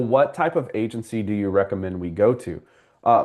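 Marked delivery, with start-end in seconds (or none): none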